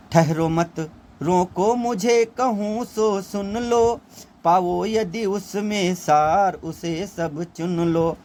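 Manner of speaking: Kaikeyi's lament recited slowly as verse; poem recitation. Acted. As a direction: lift the line and stretch it like recited poetry.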